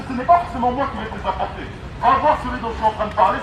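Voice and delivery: normal talking voice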